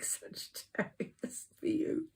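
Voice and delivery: breathy whispered aside; hushed intimate whisper. Real, not acted. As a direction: whisper it like a secret. Intimate address to sign.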